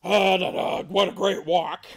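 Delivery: lightly voiced loud father bear; Papa Bear. Papa Bear home from a walk, lightly voiced and loud. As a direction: sing out a little, loudly. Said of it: "Papa Bear".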